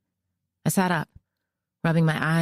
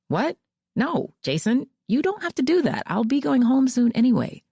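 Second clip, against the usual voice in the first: much higher, startled objection; surprised protest; 9 semitones higher than usual. A narrator's reading. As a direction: object in alarm, quickly.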